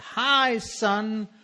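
hint of a wheedling father; father's letter voice. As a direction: read the letter as dad, sweetly fake. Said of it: "It said".

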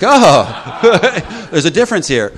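laughing through the words. Retold or imitated. Quoting himself to the group, laughing. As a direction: chuckle while saying it. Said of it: "I'm like".

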